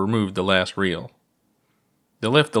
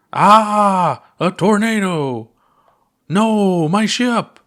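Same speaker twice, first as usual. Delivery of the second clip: high, loud mock panic cries; hammed-up panic; 10 semitones higher, 7 dB louder, much slower than usual. Puppet pilot cries out as tornado hits, hammed-up panic. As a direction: squeal like a toy pilot in a storm.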